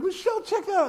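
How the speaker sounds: high-pitched hey-look-at-that to Michelle; mock nudging wife. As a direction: point it out gleefully. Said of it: "I was like".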